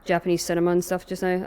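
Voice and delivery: ordinary talk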